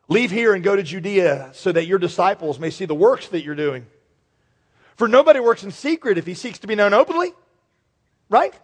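mocking, scornful taunt; sarcastic reading. Shown as a demonstration. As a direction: read it with a sneer. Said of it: "I'm reading that sarcastically".

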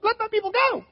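weak, squeaky Moses pleading with Pharaoh; mousy high voice. Retold or imitated. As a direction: squeak it out like a meek little man. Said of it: "It was probably more like".

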